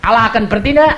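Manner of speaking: retold command. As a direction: tell them to give thanks. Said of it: "saya bilang".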